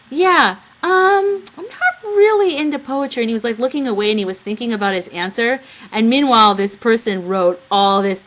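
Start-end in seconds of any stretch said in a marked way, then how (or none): 0.1-3.2 high, earnest put-on voice, louder; imitating David. Retelling David's chat answer, high earnest imitation. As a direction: play David's innocent sincere reply. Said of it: "David's totally into it, going".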